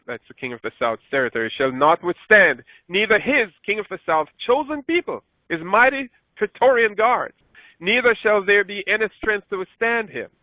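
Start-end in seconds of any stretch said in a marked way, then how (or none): 1.5-10.3 scripture read higher, with rising emphasis; preacher reading with mounting emphasis; amplified scripture reading. Acted. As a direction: build the verse up with explanations woven in.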